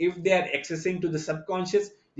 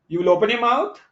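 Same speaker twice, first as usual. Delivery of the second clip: "you will open your mouth" climbing higher and louder into a question; rising tone; 7 semitones higher, 7 dB louder than usual. Shown as a demonstration. Teacher showing students how a rising tone makes a question. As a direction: let "mouth" lift so it sounds uncertain. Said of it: "If I go take it as a rising tone".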